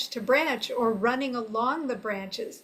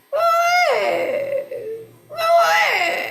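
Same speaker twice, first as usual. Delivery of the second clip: high, loud squawking bird call; go-away bird call; 7 dB louder, much slower than usual. Shown as a demonstration. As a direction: screech it like a grumpy bird. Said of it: "they sound like".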